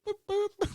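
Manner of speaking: high robotic boops; mock beeping. Cohost imitates a dancing gadget tree with high boops. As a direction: chirp it like a toy gadget.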